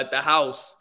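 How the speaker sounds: ordinary talk, nothing marked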